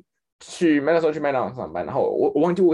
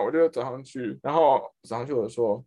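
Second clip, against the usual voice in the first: normal talking voice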